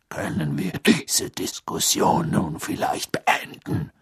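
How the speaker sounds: slow, breathy rasping growl. Acted. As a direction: growl it impatiently. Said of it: "knurrte Moody".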